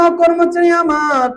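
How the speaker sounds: high, slow chanted summons; king calling out. Retold or imitated. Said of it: "রাজা ডাক দিয়ে বললেন".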